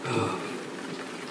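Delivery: low, soft, breathy sigh. Acted. Storyteller pausing before telling of Buffo, soft sigh. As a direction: let out a quiet sigh.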